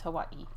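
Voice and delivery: Hawaii with a small stop before the last ee; tentative Hawaii with the apostrophe catch; Hawaiian-language pronunciation. Shown as a demonstration. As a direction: say Hawaii as huh-WAH, break, EE. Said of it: "there's, like, a little apostrophe".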